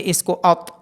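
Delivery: usual speaking voice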